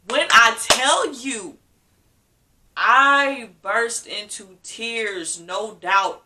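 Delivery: slow it down and lean in; dramatic telling, drawn out; emphatic. Real, not acted. Recounting bursting into tears.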